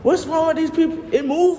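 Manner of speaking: high, loud alarm. Real, not acted. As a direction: shout in disbelief. Reacting to a moving creature.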